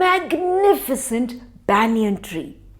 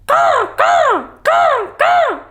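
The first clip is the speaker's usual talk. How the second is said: high bright crow calls; crow caws; 3 dB louder, slower than usual. Acted. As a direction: caw out like a crow in love. Happy crows calling.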